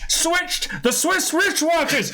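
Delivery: tongue twister broken by laughter; giggling frustrated attempt; laughing through it. Real, not acted. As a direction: try it while cracking up. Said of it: "getting so angry, but also laughing a lot".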